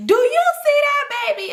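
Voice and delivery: put-on loud, very high little-kid squeal; Cheryl. Little girl loudly pointing out a baby, squealing. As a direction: mimic a shrieking child, pitch way up. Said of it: "I'm gonna talk regular".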